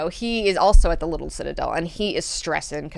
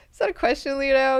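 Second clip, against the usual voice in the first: higher, pressing repeat of the question; insistent repeat; 8 semitones higher, faster than usual. Retold or imitated. Mineo pressing Leto again after his riddle. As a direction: push him for an answer.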